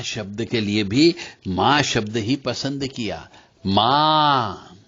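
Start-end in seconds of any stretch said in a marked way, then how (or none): 3.7-4.8 माँ held very long on one breath; slow, sustained माँ as a chant example; demonstrating the sound. Shown as a demonstration. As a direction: stretch the vowel of माँ right out. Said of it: "ये बोलोगे तो इससे मानसिक रोग दूर".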